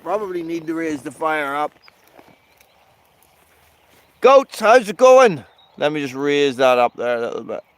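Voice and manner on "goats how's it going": higher, louder and slow, a friendly call across the field; calling out to goats. Real, not acted. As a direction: hail the goats from a distance.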